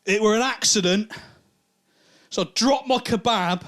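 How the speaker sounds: put-on drunk mate explaining himself to the lads; sheepish excuse. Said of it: "turns around, he goes".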